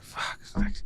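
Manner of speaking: under-the-breath whispered curse; muttered swearing. Retold or imitated. Husband seeing mushy eggs, whispering curses.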